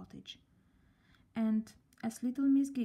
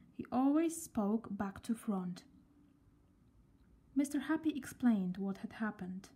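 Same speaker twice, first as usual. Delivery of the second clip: ordinary delivery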